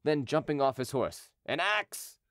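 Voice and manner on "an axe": high-pitched urgent demand; crying out. A narrator's reading. Henry at the gate demanding a weapon, crying out. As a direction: call out for it, pitched up. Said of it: "cried he".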